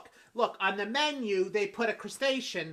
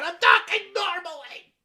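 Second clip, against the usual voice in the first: loud, higher protest; offended loud denial; indignant yell; 8 semitones higher, 9 dB louder than usual. Acted. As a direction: shout that you're not shouting. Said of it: "keep your voice down".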